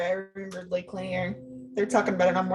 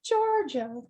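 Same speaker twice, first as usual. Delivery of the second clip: TV announcer; 5 semitones higher than usual. Retold or imitated. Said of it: "at the end it goes".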